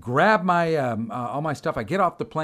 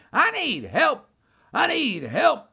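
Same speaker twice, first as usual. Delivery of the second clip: high, sing-out parroting; high-pitched copycat call for help; mocking mimicry; 11 semitones higher, 4 dB louder, slower than usual. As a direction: imitate a stranger echoing your cry. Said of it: "he starts mimicking me".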